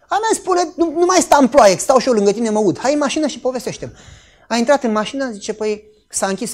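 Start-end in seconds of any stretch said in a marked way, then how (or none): 0.1-3.9 high, loud retold pleading, easing gradually; urgent insistence. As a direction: re-enact urging someone in out of the rain. Urging crying woman out of rain.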